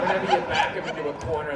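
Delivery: ordinary talk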